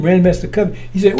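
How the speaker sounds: normal talking voice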